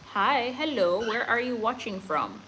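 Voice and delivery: ordinary talk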